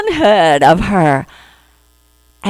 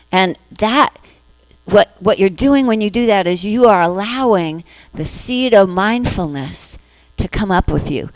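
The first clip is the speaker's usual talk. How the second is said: ordinary talk, nothing marked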